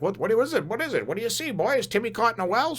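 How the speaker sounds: high, mock-worried voice asking the dog; Lassie owner parody. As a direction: ham up "what is it, boy". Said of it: "and they're like".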